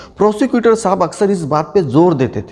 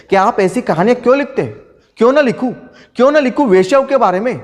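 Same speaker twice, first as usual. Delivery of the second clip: higher-pitched, challenging rhetorical question; defiant retort; 6 semitones higher, faster than usual. Acted. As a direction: throw the question back in defiance. Defiantly answers those questioning his stories. (चुनौती भरे ऊँचे सुर में पलटकर सवाल)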